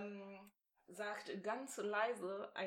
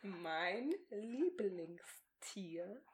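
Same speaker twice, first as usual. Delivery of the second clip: slow mouthing; 2 semitones lower, much slower than usual. Shown as a demonstration. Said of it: "repeats slowly for lip-reading".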